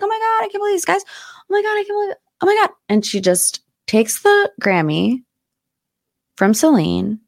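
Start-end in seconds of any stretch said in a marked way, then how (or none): 0.0-2.7 much higher, breathless mock squeal. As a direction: play a starstruck fan who can't believe it. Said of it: "She's like".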